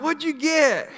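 high-pitched imitated excited question; eager onlookers. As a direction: call out like a curious crowd. Onlookers eagerly asking about the catch.

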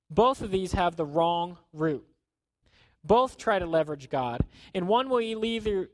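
ordinary talk, nothing marked

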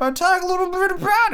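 squeaky-high playful aside; silly high voice. Pretend. Podcaster introducing game news, silly high-pitched voice. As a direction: jump far up in pitch for a joke.

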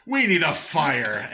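slow, high, loud mock proclamation; mock announcing. Castaway wandering about stating the obvious, mock announcing. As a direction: call it out slowly like a useless leader. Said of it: "I would just walk around saying".

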